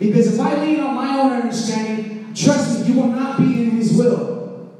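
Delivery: preaching at full force. Acted. Pastor urging the congregation, full preaching voice. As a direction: preach it out to the whole room.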